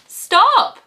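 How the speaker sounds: put-on high, loud storybook boy's cry. A narrator's reading. Boy to troll on the bridge, high loud cry. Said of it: "cries the boy".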